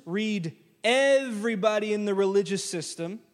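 ordinary talk

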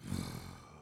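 a long, deep exasperated exhale; deep breathy groan of disbelief; heavy sigh. Retold or imitated. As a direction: breathe out like a fed-up mover. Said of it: "they just breathed".

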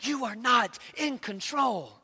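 God speaking quietly into the king's ear; intimate low whisper. A narrator's reading. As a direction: lean in and say it softly and slowly. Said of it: "whisper into his ear".

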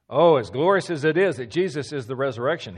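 ordinary delivery

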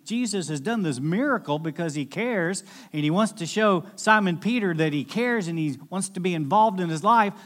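ordinary delivery

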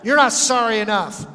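put-on exasperated parent telling a child off; scolding parent. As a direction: scold like a fed-up parent, hitting "not". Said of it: "How many parents have said that?".

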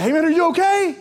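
high, loud worried call across the gym; concerned call. Retold or imitated. He calls to the squatting guy: loud worried question. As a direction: call out as if he might be hurt. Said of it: "I was like".

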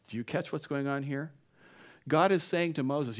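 usual speaking voice

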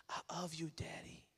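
put-on soft child's whisper, breathy at the end; tender bedtime whisper; whispered I love you. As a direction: whisper it like a small girl at the bedside. Toddler whispers to sleeping dad.

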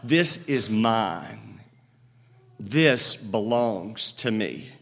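slow, low proclamation of ownership; Christ crying out. Retold or imitated. Jesus claiming all creation, slow and low. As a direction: claim it slowly and deeply. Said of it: "Christ does not cry out".

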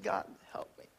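breathless whisper; whispered prayer. Retold or imitated. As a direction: whisper a desperate plea for help. Desperate prayer when starving.

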